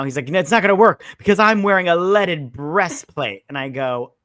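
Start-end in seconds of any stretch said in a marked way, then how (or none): 0.3-3.4 loud, higher-pitched boast; imitating a cocky comeback; mock defiant. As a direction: declare it with hammed-up triumph.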